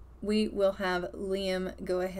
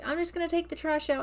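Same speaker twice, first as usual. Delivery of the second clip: voicing the son, quick; 7 semitones higher, faster than usual. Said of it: "and he's like".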